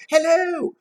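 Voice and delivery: shrieky high-pitched greeting; very high hello. Shown as a demonstration. Trying out the boy's reply, squealing high. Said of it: "Did he go".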